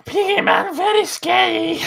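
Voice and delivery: high, slow mocking goblin. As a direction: tease in a squeaky goblin voice. Goblin mocks the party.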